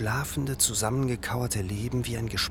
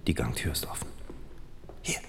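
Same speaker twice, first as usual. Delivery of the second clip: low hushed whisper; 5 semitones lower, 5 dB quieter than usual. Acted. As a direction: whisper so nobody below hears. Sneaking up dark stairs quietly.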